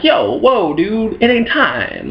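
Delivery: high startled Jesus. Acted. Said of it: "Jesus is like".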